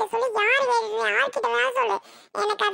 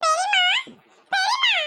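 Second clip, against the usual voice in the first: high-pitched call to an aunt; 8 semitones higher than usual. Acted. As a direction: call her twice, voice rising higher. Calling out to aunt. (உயர்ந்த குரலில் அழைத்தல்)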